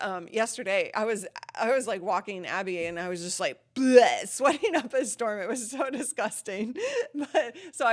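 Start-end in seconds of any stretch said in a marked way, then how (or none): 3.7-4.1 loud blurted "bleh" of disgust. Retold or imitated. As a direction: blurt out a loud noise of revulsion. Mimes disgust at sweaty walk.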